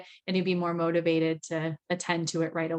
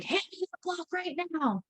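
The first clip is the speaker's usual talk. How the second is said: put-on sharp, high, pushy order to a child; a stern mom barking an order; harsh demanding command; 10 semitones higher, 6 dB quieter than usual. Shown as a demonstration. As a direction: snap the order at the child like an impatient parent. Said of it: "instead of like".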